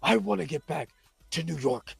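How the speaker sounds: low, hushed dramatic voice; soft, deep, hammed-up monologue; brooding character voice. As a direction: drop low and quiet, like a brooding antihero.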